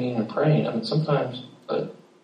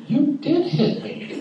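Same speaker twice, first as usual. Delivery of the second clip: put-on high, slow little-girl voice, half laughing; 14 semitones higher, slower than usual. Pastor voicing his young daughter to her brother, high and giggly. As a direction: play the small sister, amused.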